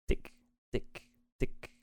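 soft, nearly voiceless little ticks; polite tapping. A narrator's reading. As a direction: tap the words out lightly and politely. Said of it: "overging in een beleefde". (beleefde, zachte tikjes)

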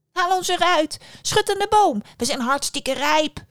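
high, loud little apple voices; calling apples. Acted. Apples on the tree beg the passing girl, high urgent calling. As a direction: cry out urgently to be shaken down.

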